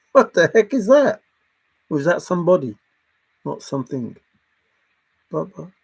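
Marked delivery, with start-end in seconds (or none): 0.1-1.2 laughing disbelief. Real, not acted. Laughing at the odd noise.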